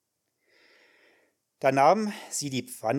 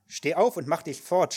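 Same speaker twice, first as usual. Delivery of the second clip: Amnon shouts her away, higher and louder; shouted; 5 semitones higher, 5 dB louder than usual. A narrator's reading. Prince driving Tamar away, angry shout. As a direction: bark the order to get out. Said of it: "schrie er sie an".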